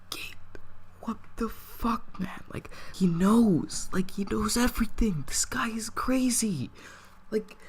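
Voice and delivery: urgent panicked whisper, nearly no voice. Retold or imitated. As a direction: hiss it so dad can't hear. Panicked whisper in the change room.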